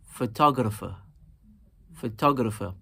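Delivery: "photographer" with TOG stressed, then falling; stress on second syllable. Shown as a demonstration. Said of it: "we are also emphasizing the second syllable".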